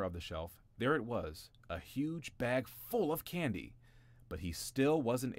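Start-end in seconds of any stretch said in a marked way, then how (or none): 1.7-3.8 drawn out slowly, higher, in awe; slow wonder. A narrator's reading. Narrator lingering over the candy slowly. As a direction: savor each word like a treasure.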